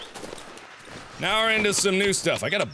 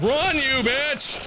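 high, drawn-out cry; urgent command; 7 semitones higher, much slower than usual. Real, not acted. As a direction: urge your game character to flee. Urging character to flee.

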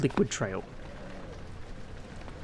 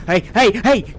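sharp, loud, higher hey hey hey; alarmed shout; 7 semitones higher, 10 dB louder than usual. Real, not acted. Player yelling at a monster stealing his things, alarmed shout. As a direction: yell to stop the thief.

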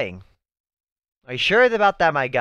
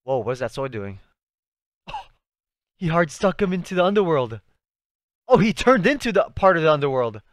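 ordinary talk

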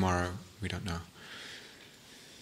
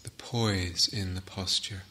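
unhurried, softer phrases left to settle in silence; guided meditation; 6 dB quieter than usual. Acted.

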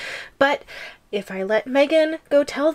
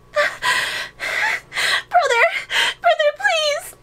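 much higher tearful plea; crying out for brother. Acted. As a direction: wail for help like a tiny trapped girl. Voicing the captive girl pleading.

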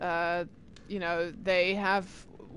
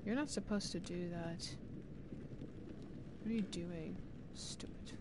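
muttered to himself; 2 semitones lower, 16 dB quieter than usual. Real, not acted. Grumbling at game under breath.

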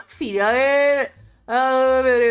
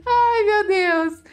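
high amused wail; 7 semitones higher, much slower than usual. Real, not acted. Exclama depois de rir.

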